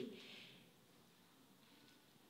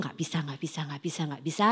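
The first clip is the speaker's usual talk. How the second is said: muttered refusals; 7 semitones lower, 10 dB quieter, faster than usual. Retold or imitated. Mocking repeated refusals.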